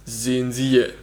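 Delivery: slow trailer announcer voice; movie trailer voice. Retold or imitated. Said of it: "Kino-Trailer-Stimme". (Kino-Trailer-Stimme)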